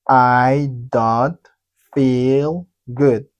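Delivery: "I don't feel good" slow and firm, paused mid-phrase; slow repeat-after-me model. Shown as a demonstration. Teacher asking learners to repeat, slow spaced-out words. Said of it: "Vous répétez".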